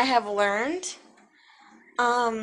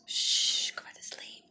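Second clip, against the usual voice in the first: whispering over a crib; 7 dB quieter than usual. Real, not acted. Baby waking, hushing him.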